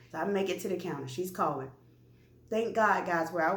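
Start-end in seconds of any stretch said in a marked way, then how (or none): none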